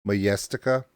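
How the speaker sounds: Majestica tried with a Y sound, questioning rise; Swedish-style pronunciation. Shown as a demonstration. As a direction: test out "ma-YES-tica" for Majestica. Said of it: "Should I be saying Majestica?".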